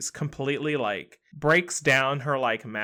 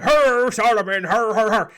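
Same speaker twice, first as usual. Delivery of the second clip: high, loud, dim-witted king voice, much higher and louder; dopey fake king; 8 semitones higher, 9 dB louder than usual. Fake king stumbling in and greeting Solomon, loud dopey voice.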